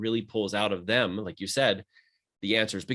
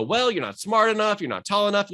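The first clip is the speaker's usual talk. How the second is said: mock-dismissive voice, pitched higher; discouraging head voice; 8 semitones higher than usual. The doubting head voice shooting down a kid's dream, mock-dismissive. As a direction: brush the dream off as the doubting inner voice. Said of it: "talking from your head voice and go".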